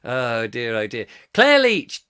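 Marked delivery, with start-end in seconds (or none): 1.3-2.1 raised, louder name call. Real, not acted. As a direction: sing out the listener's name. Reading out a listener's name.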